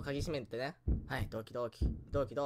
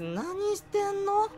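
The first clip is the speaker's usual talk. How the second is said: slow, much higher disbelieving whine; incredulous; 13 semitones higher, 3 dB louder, much slower than usual. Real, not acted. Player baffled by the enemy's antics, incredulous. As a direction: drag out the question in disbelief.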